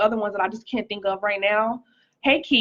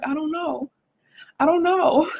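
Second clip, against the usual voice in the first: bewildered; 7 semitones higher than usual. Retold or imitated. Retold bewildered answer.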